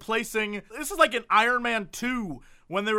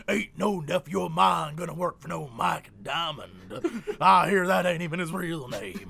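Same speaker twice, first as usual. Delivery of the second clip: lower-voiced uncle scoffing; gruff uncle; 4 semitones lower than usual. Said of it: "And then he's like".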